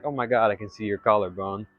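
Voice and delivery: ordinary talk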